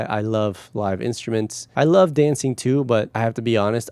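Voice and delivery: ordinary talk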